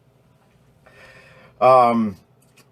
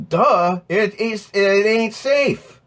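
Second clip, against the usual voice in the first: mocking; 9 semitones higher, slower than usual. Mocking tone in rant.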